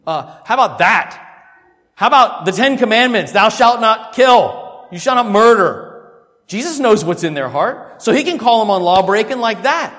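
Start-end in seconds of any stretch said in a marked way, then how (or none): 3.3-6.1 slow, loud shouted proclamation; proclaiming commandment. Acted.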